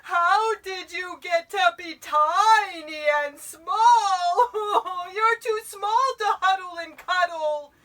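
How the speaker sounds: loud, high, drawn-out tearful wail; bawling bear. A narrator's reading. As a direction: bawl it out like a sobbing giant bear. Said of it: "could hear the voice bawl".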